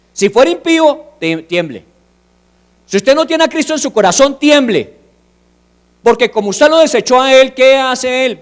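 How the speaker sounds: full-force sermon climax; fiery preaching. Acted. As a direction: preach it hard to the congregation.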